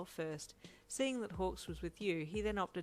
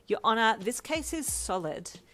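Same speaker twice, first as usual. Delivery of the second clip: rest your case grandly; mock lawyer; 5 semitones higher, 8 dB louder than usual. A narrator's reading. Mock courtroom closing.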